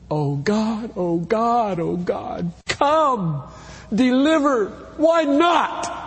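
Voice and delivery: high pleading cry building to a shout; anguished scream. Pretend. Preacher imagining his daughter trapped, screaming prayer. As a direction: plead to God, rising to a shout. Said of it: "I will scream".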